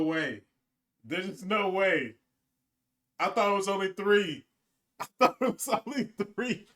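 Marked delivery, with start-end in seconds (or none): 4.7-6.7 fast, higher, through laughter; laughing disbelief. Real, not acted. Gamer laughing in disbelief at speech.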